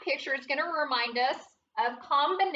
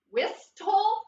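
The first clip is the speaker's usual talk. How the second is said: "Whistle?" raised high and louder as a puzzled check; rising, questioning "Whistle?"; doubtful question; 7 semitones higher, 4 dB louder than usual. Shown as a demonstration. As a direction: ask "Whistle?" like it sounds wrong. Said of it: "Is that how we pronounce that word?".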